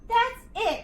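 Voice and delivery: frustrated cry. A narrator's reading. George exhausted on the mountain, frustrated cry. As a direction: give up in loud frustration. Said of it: "he cried".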